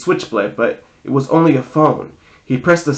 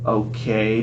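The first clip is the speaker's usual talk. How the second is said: low, soft stage whisper; hushed, lowered aside; 4 semitones lower, 7 dB quieter than usual. A narrator's reading. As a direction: drop your voice to confide. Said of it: "Bianca whispered to me".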